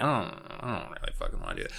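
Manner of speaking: fast, soft mumble; mumbled reluctance. Retold or imitated. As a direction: mumble it, not wanting to. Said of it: "Amy's just like".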